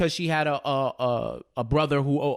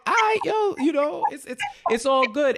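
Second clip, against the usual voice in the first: uneasy Tariq; 9 semitones higher than usual. Retold or imitated. Voicing Tariq brushing it off.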